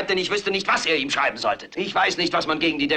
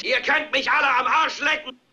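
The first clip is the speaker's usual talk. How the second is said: higher, raging shout; shouted defiance; 7 semitones higher than usual. Acted. Anchor leads the window yell for the viewers, shouting. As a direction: yell it to the rooftops. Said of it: "steckt den Kopf raus und schreit".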